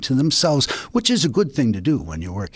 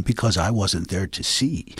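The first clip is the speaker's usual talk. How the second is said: ordinary delivery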